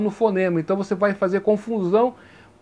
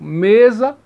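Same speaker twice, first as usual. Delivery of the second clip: mesa with a loud, strong ME; mesa, first syllable hit hard; stress on first syllable; 6 dB louder than usual. Shown as a demonstration. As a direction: punch the ME in mesa, za weaker. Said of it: "o me é mais forte".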